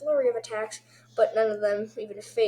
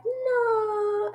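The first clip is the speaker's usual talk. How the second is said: high, held cry of refusal; mock dramatic no; 11 semitones higher than usual. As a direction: hold a high-pitched theatrical no. Voicing a character's cry of no.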